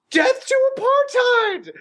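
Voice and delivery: very high, slow mock rallying cry; mock battle cry. Mocking the tiny leftist raising his fist, high mock battle cry. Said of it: "raising his little Skeletor fist in the air, going".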